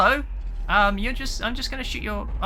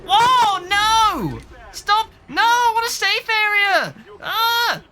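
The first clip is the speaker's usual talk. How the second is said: high, loud desperate wail; panicked crying out; 11 semitones higher, 5 dB louder, slower than usual. Real, not acted. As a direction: plead like you're about to die. Attacked, begs for safe area.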